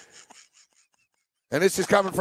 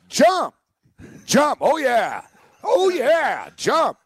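much higher, louder rock-star yelps; 8 semitones higher, 4 dB louder, much slower than usual. Retold or imitated. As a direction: belt it out like a hammy rock frontman.

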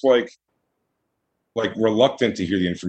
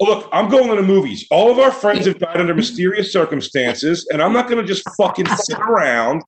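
put-on higher, laughing through a stubborn teen's line; laughing mock defiance; 8 semitones higher than usual. Teen refusing to believe the killer, laughing higher voice. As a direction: crack up while playing the dismissive friend.

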